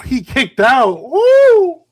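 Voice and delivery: loud, high, thrilled cheer; excited shout. Real, not acted. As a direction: yell it at a kickout. Near-fall kickout on live match.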